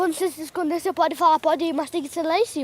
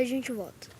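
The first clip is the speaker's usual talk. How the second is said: normal talking voice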